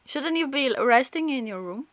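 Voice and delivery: usual speaking voice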